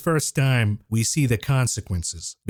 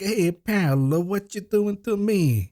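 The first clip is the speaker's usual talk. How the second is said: mock Italian accent, higher and slow, hamming up Paolo; overdone movie-style Italian accent, drawn out and sing-song; comical Italian accent; 9 semitones higher, slower than usual. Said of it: "it's really comical Italian accent".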